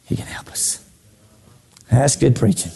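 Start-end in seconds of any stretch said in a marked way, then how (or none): 0.1-0.8 hushed whisper after the sermon cry; sudden whisper. Acted. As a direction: drop to a whisper for emphasis. Preacher drops to a whisper.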